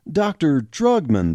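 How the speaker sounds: plain reading voice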